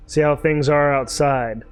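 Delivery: usual speaking voice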